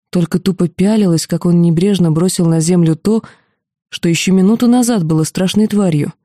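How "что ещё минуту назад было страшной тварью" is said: ordinary delivery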